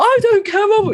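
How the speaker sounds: put-on high, whiny, defiant teen snap; stroppy teenager. Teenage daughter snapping back at mum, stroppy and high. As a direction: throw it back at mum like a sulky fourteen-year-old. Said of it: "stroppy hormonal gross horrid me".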